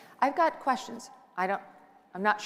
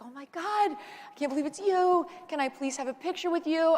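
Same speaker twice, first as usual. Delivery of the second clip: high, gushing, starstruck fan voice; excited fan; 9 semitones higher than usual. Retold or imitated. Imitating an excited fan at the inauguration who asks for a selfie, high and gushing. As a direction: play the thrilled stranger begging for a photo. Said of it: "he was so excited to see me".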